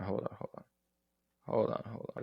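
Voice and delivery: half-whispered hold on, hold on while looking something up; muttered under breath. Real, not acted. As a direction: mutter it to yourself, barely voiced. Mutters while searching the game.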